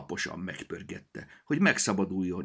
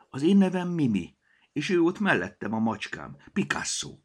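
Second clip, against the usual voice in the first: put-on little girl Mimi; 8 semitones higher, 5 dB louder than usual. A narrator's reading. Mimi introduces herself.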